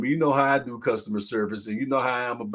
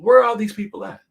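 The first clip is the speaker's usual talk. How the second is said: louder, higher rhetorical "where"; exasperated call-out; 7 semitones higher, 8 dB louder than usual. Real, not acted. Asking viewers where workers went, louder and higher. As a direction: throw the question out, exasperated.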